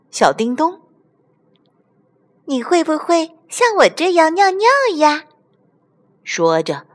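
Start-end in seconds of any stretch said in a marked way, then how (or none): 2.5-5.3 put-on high-pitched childlike doll; little doll voice. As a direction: ask in a tiny high voice. Little doll Dingdong asks Xiaohan. (又高又细的小娃娃嗓音)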